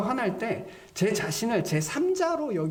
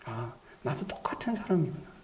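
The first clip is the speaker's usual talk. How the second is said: low, half-whispered admission; shrinking small voice; 5 semitones lower, 4 dB quieter than usual. Acted. Sinner realising they are just the same, voice shrinking. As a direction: let the voice dwindle in humbled realisation. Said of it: "소리가 점점 작아져요".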